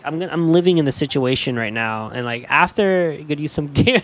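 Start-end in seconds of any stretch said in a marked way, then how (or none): none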